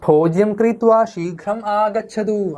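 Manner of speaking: slow, deliberate Sanskrit line in a lightly acted uncle voice; uncle's slow message. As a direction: read the uncle's order slowly. (പതുക്കെ അമ്മാവന്റെ ശബ്ദത്തിൽ)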